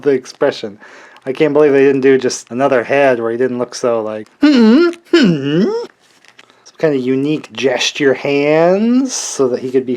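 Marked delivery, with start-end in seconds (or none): 4.4-5.6 mock smug hum. Mimicking the figure's expression.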